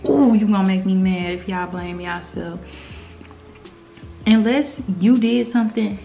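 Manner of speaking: ordinary delivery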